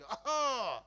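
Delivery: sharp loud throat clear. Retold or imitated. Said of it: "ખાવ ખોખારો".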